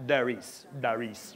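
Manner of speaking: Darius in a Jamaican accent. Shown as a demonstration. Preacher showing how to say the name, Jamaican accent. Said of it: "say it with a Jamaican accent".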